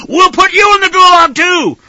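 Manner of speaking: high, loud mocking yell; sarcastic high-pitched yell; mock shout. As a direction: shout it like a sneering official threatening the crowd. Mocking officials threatening dissenters.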